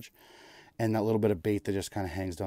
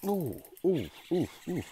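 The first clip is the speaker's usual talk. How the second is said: excited ooh; 8 semitones higher than usual. Real, not acted. Fish strikes on ice.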